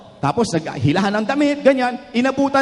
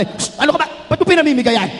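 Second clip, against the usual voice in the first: mock scolding; 7 semitones higher, faster than usual. Mother scolds child.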